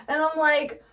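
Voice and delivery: ordinary talk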